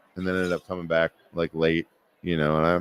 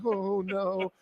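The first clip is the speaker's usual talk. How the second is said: shrinking; 10 semitones higher, 7 dB quieter than usual. Retold or imitated. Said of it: "I immediately shrunk".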